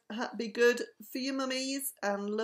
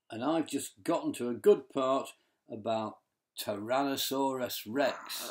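low deep voice; 8 semitones lower than usual. Acted. Bella low dinosaur voice.

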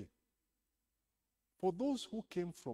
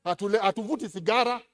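loud, raised preaching voice; 6 semitones higher, 13 dB louder than usual. Acted. Pastor rousing the congregation, loud and raised. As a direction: proclaim it to the church.

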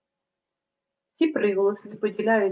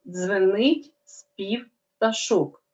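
slow reading aloud; slower than usual. Shown as a demonstration. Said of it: "Прочитаємо речення".

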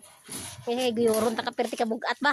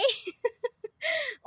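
high burst of laughter; 10 semitones higher than usual. Real, not acted. Laughing at the angry cat.

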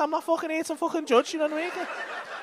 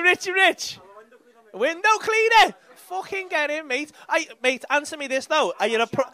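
usual speaking voice

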